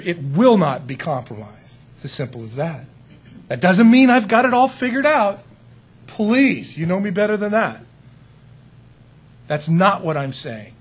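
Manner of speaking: ordinary talk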